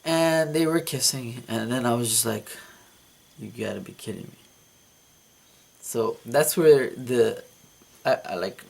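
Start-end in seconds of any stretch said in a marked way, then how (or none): 3.4-4.4 much softer and fast, a resigned mutter; defeated mutter. Retold or imitated. Dreamer watching Kate kiss his old manager, soft defeated mutter. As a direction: mutter it under your breath, beaten.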